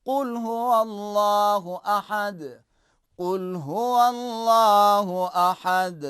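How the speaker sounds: قل with a hard, heavy q, slow and loud; slow, loud recitation with a hard ق; forceful qaaf. Shown as a demonstration. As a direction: recite قل هو اللہ احد with a forceful ق. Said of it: "زور سے".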